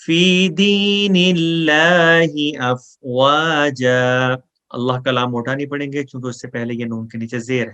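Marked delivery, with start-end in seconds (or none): 0.0-4.4 slow, melodic tajweed chant; Quran recitation. Shown as a demonstration. Teacher reciting for students to repeat after, slow melodic chant. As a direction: recite it slowly for students to echo.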